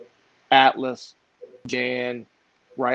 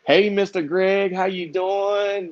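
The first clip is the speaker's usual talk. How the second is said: slow, much higher, sing-song hello; sweet friendly greeting; 6 semitones higher, much slower than usual. Retold or imitated. Clerk now greeting Greg warmly on the phone, drawn-out sweet hello. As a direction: coo it like a happy former grump. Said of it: "once I convinced her".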